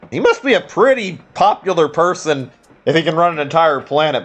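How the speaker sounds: character voice. Acted. Reads cat character's lines.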